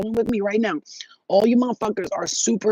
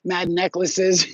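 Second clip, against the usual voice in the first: usual speaking voice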